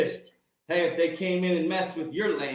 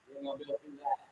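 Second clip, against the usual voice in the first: softer, almost under the breath; softer warning; 4 semitones lower, 13 dB quieter, much faster than usual. Shown as a demonstration. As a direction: drop it nearly to a murmur. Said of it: "repeated line, dropped".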